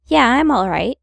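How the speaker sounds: normal talking voice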